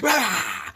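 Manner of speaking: high-pitched "rah" of attack; imitated dog snarl. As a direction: mimic a dog going for the neck. Imitating dog grabbing the neck.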